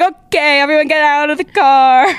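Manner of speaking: high, loud panicked command; urgent order. Retold or imitated. Ordering friends out of the taxi, high and loud. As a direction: get everyone out, fast. Panicked after driver threat.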